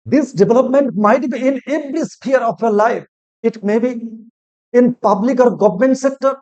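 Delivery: slow, loud, high orator's delivery; inspiring teacher's declamation; motivational speech. Acted. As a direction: address the class like a rousing speaker, drawing each line out. Motivational English intro to class.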